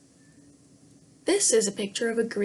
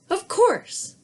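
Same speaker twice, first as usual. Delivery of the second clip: much higher, louder quick yes; hasty promise; 10 semitones higher, 5 dB louder than usual. Acted. Princess promises the frog, high and eager. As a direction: toss off the promise brightly.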